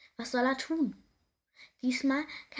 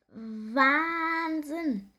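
amazed; 5 semitones higher, 3 dB louder than usual. Acted. Nick amazed on Bully's back.

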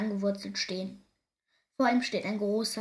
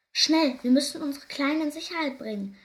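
raised, louder storybook warning; urgent call; 5 semitones higher, 7 dB louder than usual. A narrator's reading. Hare father warning of the fox, urgent call. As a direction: call out the alarm, higher and louder. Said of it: "ruft der Hasenvater".

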